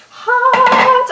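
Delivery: very high yelp at heat; pained exclamation. Real, not acted. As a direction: cry out high, as if burnt. Reacts to touching something hot.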